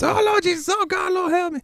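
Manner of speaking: very high, mock-wailing plea; comic mother tumbling endlessly downstairs; Eddie Murphy's mom. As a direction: shriek for help in a hammed-up falsetto. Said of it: "Eddie Murphy's mom falling down the stairs".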